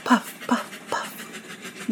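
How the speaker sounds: breathy unvoiced puffs dying away; weak engine heartbeat puffs; fading puffs. A narrator's reading. Narrating the train's fading heart.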